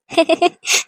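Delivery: grandmother's small chuckle; laugh. Real, not acted. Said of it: "சிரிக்காத பாட்டி".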